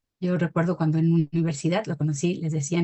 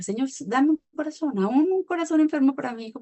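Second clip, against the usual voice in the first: pleading prayer; 7 semitones higher than usual. Retold or imitated. Retold desperate prayer for baby.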